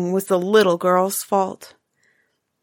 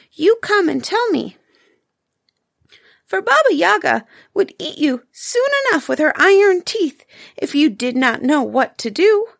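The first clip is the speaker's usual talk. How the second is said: high little mouse, kind and earnest; a tiny mouse giving a friendly warning; small squeaky voice, a bit urgent; 8 semitones higher than usual. Acted. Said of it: "in a little squeaky voice".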